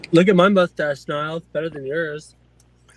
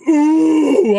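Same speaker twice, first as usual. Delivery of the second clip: high loud hooting ooh; loud taunting ooh cry; mock angry ooh; 9 semitones higher, 7 dB louder than usual. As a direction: hoot it out to taunt them. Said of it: "I'm getting so pissed".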